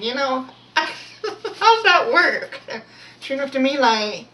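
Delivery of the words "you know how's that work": high, loud, laughing challenge. Real, not acted. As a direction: laugh through the incredulous question, drawing it out. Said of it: "I laugh 'cause my mother and I kinda got into it".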